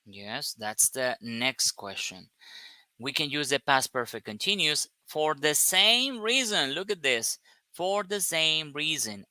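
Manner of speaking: normal talking voice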